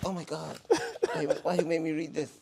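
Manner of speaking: a hint of the stunned dad; mock shocked father. Retelling the girl's father, mildly high and shocked. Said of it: "her father was like".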